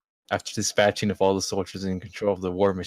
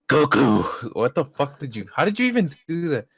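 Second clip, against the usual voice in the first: louder, higher hammed-up villain; gloating villain; 6 semitones higher, 5 dB louder than usual. Hitler gloats at Goku in a loud, high hammy voice. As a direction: ham up the gloating Nazi villain.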